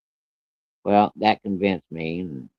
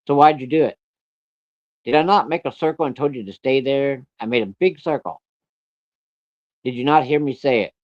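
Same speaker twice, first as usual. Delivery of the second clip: acted scolding; 6 semitones higher than usual. Acted father interrogating child.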